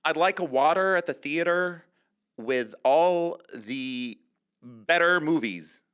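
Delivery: slow, loud American demo with drawn-out words; exaggerated American Valley Girl accent. Shown as a demonstration. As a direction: spell out the American accent for a learner. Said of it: "Can you do an American accent?".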